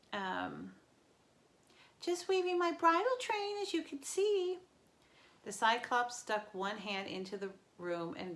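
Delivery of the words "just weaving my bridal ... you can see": much higher, louder fake bride voice; nervous satyr playing a bride; Grover in falsetto. A narrator's reading.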